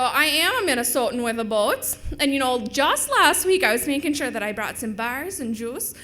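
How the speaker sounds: loud, hammed-up Minnesotan; Minnesota accent. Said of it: "it does exist".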